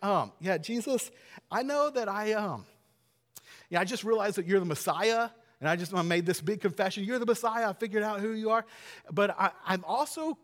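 Peter; hesitant Peter. Acted. Stall with ums while working up to an objection. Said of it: "But Peter's like".

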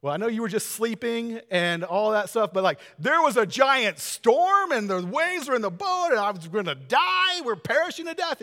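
hammed-up frantic disciples defending their fear; panicked excuse. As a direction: disciples pleading with Jesus about the storm. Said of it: "They're like".

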